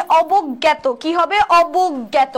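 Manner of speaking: অবজ্ঞাত as "obog-gyæto", g held double; doubled consonant. Shown as a demonstration. Teacher showing the doubled g, অবজ্ঞাত said "obog-gyæto". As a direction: hold the g in অবজ্ঞাত before "gyæto". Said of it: "শব্দটা উচ্চারণে দিত হইছে".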